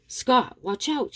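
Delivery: high, restrained cry of warning; mild scream. A narrator's reading. Abigail warning Scott about the knife, mildly screamed. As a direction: cry out without full volume. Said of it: "she screams".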